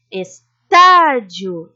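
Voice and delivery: estádio slowly, with a loud, long tá; lengthened stressed syllable. Shown as a demonstration. Teacher giving examples of proparoxytones, drawing out tá in estádio. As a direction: punch and stretch tá in estádio. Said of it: "a sílaba mais forte a gente se demora".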